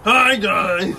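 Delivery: loud mock New Jersey greeting; hammed-up New Jersey hello; New Jersey accent. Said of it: "That's your best New Jersey accent".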